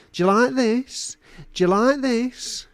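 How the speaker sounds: high-pitched goading question; taunting menace. Acted. Torturer showing a knife to his captive, taunting menace. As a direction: goad him with each repeat, pitched high.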